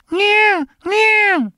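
very high squeaky cat meows; tiny high-pitched meow. Retold or imitated. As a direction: mew like a little cat. Imitating the cat's meow.

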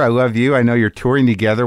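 ordinary talk

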